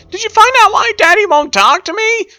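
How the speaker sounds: put-on high girlish voice of a young whale; Pearl's girlish voice.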